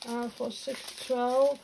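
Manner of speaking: ordinary delivery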